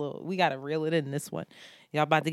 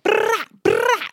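mock blast sound; 11 dB louder than usual. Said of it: "was like".

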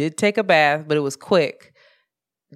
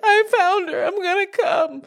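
very high, whimpering voice; mock tearful relief; 13 semitones higher than usual. Owner telling friends the cat is found, high tearful whimper. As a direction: imitate a small, weepy high whine.

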